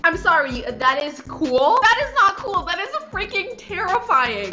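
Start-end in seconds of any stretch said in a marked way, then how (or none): 0.6-1.8 slow, questioning disbelief; incredulous echo. Real, not acted. Incredulous echo of clip's remark. 1.8-4.5 high-pitched, emphatic disbelief; alarmed protest. Real, not acted. Rebuts the clip, alarmed reaction.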